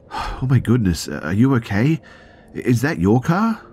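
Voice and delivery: high, loud, alarmed questions; shocked concern. Retold or imitated. Driver to injured stranger, shocked concern. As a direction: gasp out worried questions, voice climbing.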